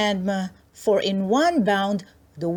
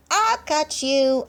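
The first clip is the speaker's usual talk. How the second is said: playful call; 7 semitones higher, slower than usual. Acted. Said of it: "she called".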